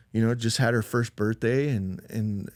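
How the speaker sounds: ordinary delivery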